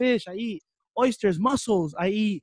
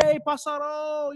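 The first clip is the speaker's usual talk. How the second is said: imitated calling out; 9 semitones higher, slower than usual. Him greeting his coach's wife at training, called out. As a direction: call out a playful greeting, stretched long.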